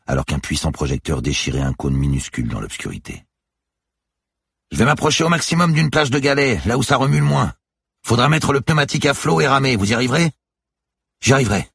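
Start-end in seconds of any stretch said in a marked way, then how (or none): 4.7-10.3 high, loud skipper's voice; brief her over the roar of the sea; urgent raised voice; shouted instructions. A narrator's reading. 11.2-11.7 raised, higher voice; loud answer over the storm; determined shouted reply. A narrator's reading.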